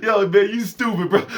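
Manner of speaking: laughing while teasing. Retold or imitated. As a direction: crack up mid-insult. Friend laughing.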